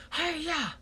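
Quasi's high karate cry. A narrator's reading. Quasi diving after the camera, high karate shout. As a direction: leap in with a high yell.

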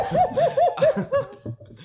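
very high, loud laugh; helpless laughter. Real, not acted. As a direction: crack up mid-story. Laughing at own drunken story.

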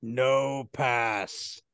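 gruff guard voice. Acted. Door guard refuses again.

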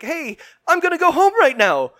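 high and loud, eager to leave; high, loud quoted voice. Retold or imitated. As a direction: pitch it high and loud to get out. Acted quote of Melissa leaving.

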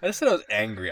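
ordinary talk, nothing marked

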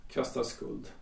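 barely voiced, stunned repetition of "Kastar skuld"; a stung husband murmuring her words back; muttered to himself. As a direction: turn "Kastar skuld" over under your breath, almost silent. Husband repeats her accusing words.

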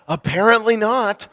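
real laugh through the words. Real, not acted. Laughing at son's joke.

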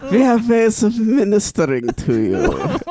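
villainous mock German accent; creepy German accent. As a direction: lay on a sinister German accent. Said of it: "a very creepy German accent".

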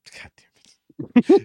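whispered, slow, drawn-out curse; exasperated whisper. Real, not acted. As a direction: breathe it out in defeat. Exasperated at soundboard.

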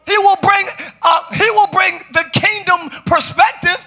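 high, strongly projected sermon voice; full-force preaching. Real, not acted. Preacher urging Christians to separate light from darkness, full-force preaching. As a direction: proclaim it with mounting force.

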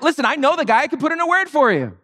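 high-pitched mock exasperation; hammed-up exasperated offer. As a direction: plead as if fed up explaining. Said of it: "He's so exasperated".

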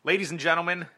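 booming, slow show intro; announcer voice. Acted. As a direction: announce the segment title like a host. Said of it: "introduce you all and welcome you".

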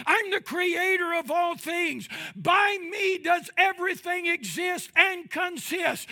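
high, loud declaration of God's might; booming voice of God. Acted. Preacher voicing God proclaiming his power, loud shout. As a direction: thunder it out as the almighty creator.

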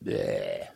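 low calf-like "bleh"; imitated calf bleat. Preacher mimicking the calf he tried to feed, bleating. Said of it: "as that calf that I was trying to feed".

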